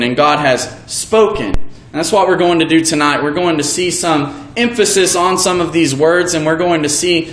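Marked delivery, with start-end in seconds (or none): 0.2-1.5 "spoken" given the weight, falling at the end; stress on SPOKEN. Shown as a demonstration. Preacher finishing the demo with stress on "spoken". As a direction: land on the word "spoken". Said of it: "we placed the emphasis on each individual word".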